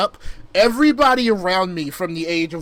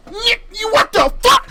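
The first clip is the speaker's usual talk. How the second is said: shocked shout; 5 dB louder than usual. Retold or imitated. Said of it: "I'm like".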